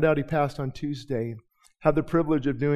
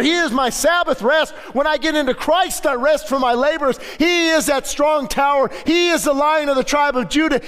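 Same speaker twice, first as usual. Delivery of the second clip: preaching at full force; 7 semitones higher than usual. Acted. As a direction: build the congregation up, proclaiming. Preaching climax.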